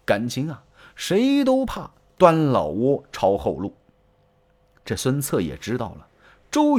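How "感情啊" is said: usual speaking voice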